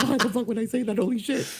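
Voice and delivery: high, giggly self-mockery; retelling a spooky night, high and laughing at his nerve; laughing disbelief. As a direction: laugh at your own past self. Recalls his own disbelief, laughing.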